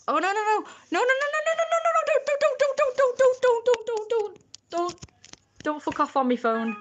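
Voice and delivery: very high, loud rapid nos; panicked shriek. Real, not acted. Streamer watching the phone slip, very high panicked shrieks.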